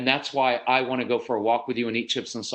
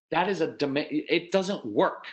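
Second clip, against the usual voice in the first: ordinary talk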